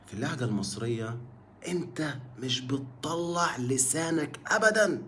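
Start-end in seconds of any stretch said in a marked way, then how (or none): none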